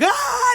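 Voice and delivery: put-on very high playful squeal of a chased kid; imitated child's shriek. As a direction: squeal like a little boy running off.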